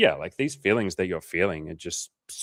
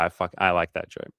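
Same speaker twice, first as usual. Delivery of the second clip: ordinary delivery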